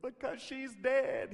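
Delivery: high, drawn-out mock protest; exasperated mourners. Mourners answer why everyone is crying, exasperated. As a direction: imitate mourners stating the painfully obvious. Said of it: "They're like".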